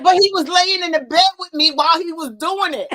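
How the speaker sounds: high, loud protest broken by laughter; laughing while pleading her case; retold protest laughing. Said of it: "I said".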